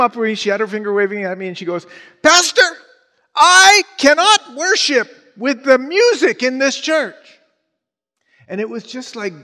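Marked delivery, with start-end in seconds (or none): 2.2-7.1 put-on high, loud East Indian-accented scolding; angry East Indian accent. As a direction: imitate an indignant churchgoer with an East Indian accent. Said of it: "she's talked with an East Indian accent".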